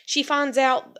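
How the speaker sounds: ordinary delivery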